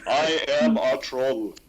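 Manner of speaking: put-on slow, heavy Norwegian-accented troll line. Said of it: "all voiced by heavy Norwegian accents".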